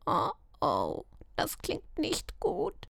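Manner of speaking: soft worried whisper. A narrator's reading. Magpie Vika whispering her fear of the druids. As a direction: breathe it out in alarm. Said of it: "Vika flüsterte".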